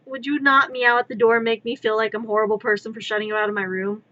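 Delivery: normal talking voice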